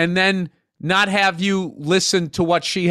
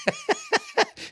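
laugh; 7 dB quieter than usual. Real, not acted. Laughing at own grunts.

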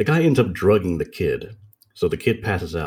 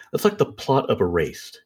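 ordinary talk

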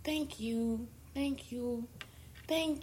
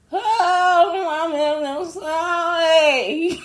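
overcome outcry; 5 semitones higher, 11 dB louder, much slower than usual. Real, not acted. Overwhelmed, voice singing out.

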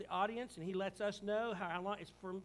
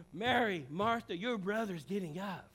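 louder, slow, insistent call to Martha; Jesus insisting to Martha, louder; emphatic call; 9 dB louder, slower than usual. Acted. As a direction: declare it to her, slowly.

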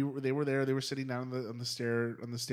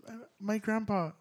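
higher-pitched half-asleep answer; imitated sleepy partner; 7 semitones higher than usual. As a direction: lightly mimic a dreamer answering before dozing off.

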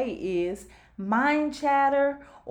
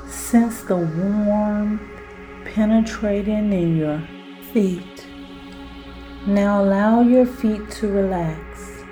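guided hypnosis; 4 semitones lower, 4 dB louder than usual. Acted. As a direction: lull the listener down into relaxation. Guided self-hypnosis exercise.

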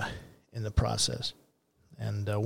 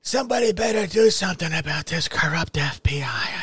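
mocking Trump; 4 semitones higher than usual. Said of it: "he's tweeting when he was president".

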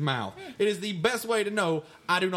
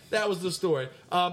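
normal talking voice

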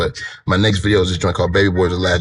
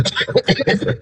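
breathy high giggle; high laugh; 13 semitones higher than usual. Real, not acted. Laughs mid-sentence.

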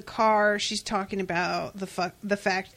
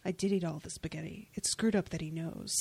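softer, quicker muttered aside; mock mutter; 5 semitones lower, 9 dB quieter than usual. Fan quoting Leonard muttering as he leaves, softer aside. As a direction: mumble it to yourself, walking away.

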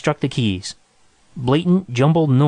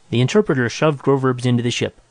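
usual speaking voice

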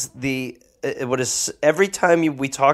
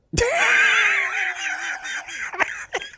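very high squealing laugh; shrieking laugh; 3 dB quieter than usual. Real, not acted. Cohost laughing at the troop numbers, high shrieking laugh.

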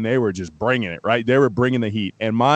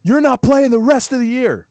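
high, hammed-up coach scream; 13 semitones higher, 3 dB louder than usual. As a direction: yell it like a furious coach tearing into his quarterback. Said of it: "He screams at me".

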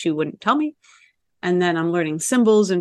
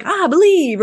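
mock believer; 10 semitones higher, 6 dB louder, slower than usual. Mocking naive believer.

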